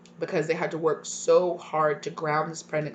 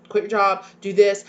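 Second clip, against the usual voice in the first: louder, insistent repeat; excited mock shout; 2 semitones higher, 7 dB louder than usual. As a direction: push it, like an eager partner insisting. Partner's excited reaction, repeated.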